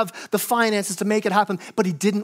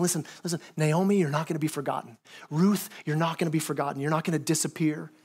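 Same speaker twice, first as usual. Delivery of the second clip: reassuring Boaz; 5 semitones lower, 6 dB quieter than usual. Retold or imitated. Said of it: "He's saying".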